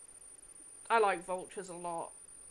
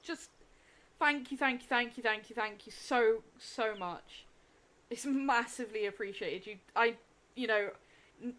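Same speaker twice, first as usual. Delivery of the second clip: usual speaking voice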